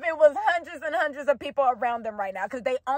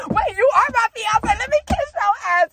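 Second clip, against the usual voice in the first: high-pitched, giddy imitation; excited realisation; 7 semitones higher, 3 dB louder than usual. Man realising she is his fiance, imitated excitedly. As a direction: act out a delighted sudden realisation. Acts out the man's sudden realisation.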